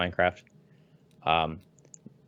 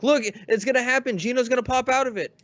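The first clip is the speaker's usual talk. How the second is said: much higher, louder hopeful cry; excited fan; 3 dB louder than usual. Retold or imitated. Imitating the hopeful fan at the reveal, high and loud. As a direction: insist the fan's hope is coming true. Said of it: "and he's like".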